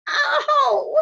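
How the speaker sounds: sharp high yelp of pain. Acted. Patrick yelping as Omri squeezes the splinter. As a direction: squeal as it's squeezed.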